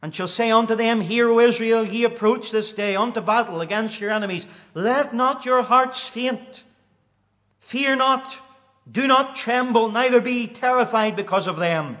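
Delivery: high, loud preacher's cry building to a peak; fervent preaching. Acted. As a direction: preach it out with rising fire. Preaching the scripture aloud.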